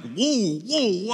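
high, startled mock whoas; mock alarm. Pregnant mother feeling twins wrestle in the womb, mock alarm. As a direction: play being jolted by a big kick.

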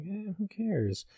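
high, soft, slow and careless; slow lazy mock indifference; dismissive shrug. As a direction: brush it off like it doesn't matter. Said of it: "I'm just going into this like".

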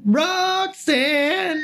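shrill high crow singing [name]; chirping bird person. Pretend. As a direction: screech the name like a crow, very high. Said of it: "the crow call sounds like".

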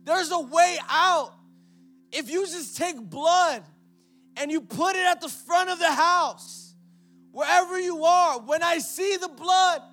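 loud, high, driving sermon climax; preaching at full force. Acted. Pastor thundering to the congregation about the blood.

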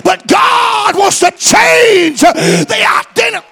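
high, loud preacher's shout; full-force preaching. Real, not acted. Preacher urging someone held hostage by shame, full-force preaching. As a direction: drive each phrase home at full volume.

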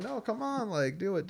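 high-pitched imitated coaxing; mock girlish pestering of a friend; egging on. Girl to her reluctant friend about the bet, coaxing.

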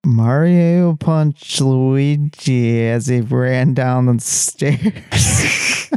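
slow, dragged-out words while hearing yourself delayed; delayed-feedback speech. Shown as a demonstration. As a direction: stretch every word, fighting your own echo. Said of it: "I'm gonna turn the mixer now".

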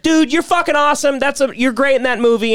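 high-pitched excited fan; gushing praise. Retold or imitated. Fan complimenting an actor at a stoplight, gushing. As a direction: gush like a thrilled fan.

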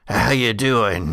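slow drawl in a put-on accent; corrected accent. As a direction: drawl it as the much older brother. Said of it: "that's the wrong accent".

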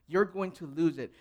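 usual speaking voice

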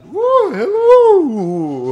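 very high, slow, loud goofy voice; squeaky cartoon character; high silly voice. NPC voice appears.

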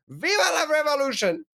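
high, ringing mock battle cry; rallying cry. Cohost cheering his cleaning revolution, in a high rallying cry. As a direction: raise the banner for the cause.

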